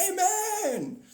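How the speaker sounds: congregation amen. Retold or imitated. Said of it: "And everyone's like".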